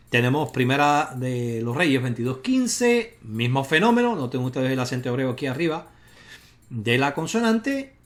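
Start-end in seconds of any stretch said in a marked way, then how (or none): none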